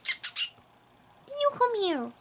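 very slow, high drawn-out coo; mocking own baby talk. Owner mimics herself calling the cat, slow mock-sweet coo. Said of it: "You're like".

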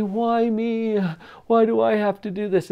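lightly whined self-pity; whiny. Retold or imitated. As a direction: imitate someone complaining about a chore. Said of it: "Usually in a whiny voice".

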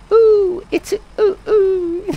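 high falsetto oohs; owl impression. Streamer reading chat's owl impression in high oohs. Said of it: "that's enough impressions now".

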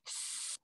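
long hissing s sound; drawn-out s like a snake. Shown as a demonstration. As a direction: hiss the S like a snake. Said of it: "It even looks like a snake".

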